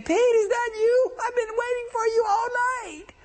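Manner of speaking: Kimmy's voice. Retold or imitated. Ex calling Pete over from across the street, high coaxing voice. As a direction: pitch way up and coo at him. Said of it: "And Kimmy's saying".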